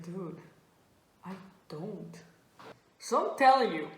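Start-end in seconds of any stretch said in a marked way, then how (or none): 0.0-2.1 hushed confession. Retold or imitated. Confessing to a friend about speaking no German, hushed. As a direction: admit it under your breath, much softer.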